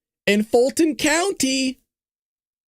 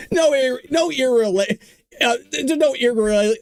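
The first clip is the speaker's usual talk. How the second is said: ordinary delivery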